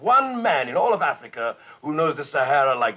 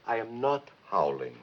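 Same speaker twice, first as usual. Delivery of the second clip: softer, measured protest; restrained denial; 3 semitones lower, 7 dB quieter than usual. Acted. Official again accused of howling by Joe, denies it with restraint. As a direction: deny it in a lowered, controlled voice. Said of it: "stop howling and get out".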